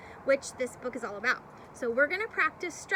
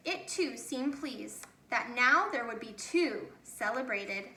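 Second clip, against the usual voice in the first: gentle read-aloud, slowing and fading at the close; story narration. Acted. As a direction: read the last page to the children, letting it wind down. Teacher reads picture book ending.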